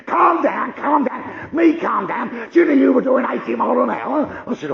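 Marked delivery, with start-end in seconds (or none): 0.1-1.5 high shouted mimicry of calm down; loud scornful echo; mock shout. Cop mocking calm down. 1.5-4.4 high, loud indignant copper; irate traffic cop; angry cop shout. Retold or imitated.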